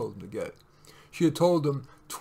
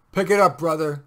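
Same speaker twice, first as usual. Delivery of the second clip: loud, curt cop's order; brusque traffic-cop command; snapped order; 3 semitones higher, 9 dB louder than usual. A narrator's reading. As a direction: snap it out like an impatient cop. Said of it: "snapped the cop".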